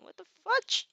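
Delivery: high squeaky disbelief; a very high, startled little cry; surprised. Real, not acted. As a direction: react to the mic glitch in disbelief. Mic acting up.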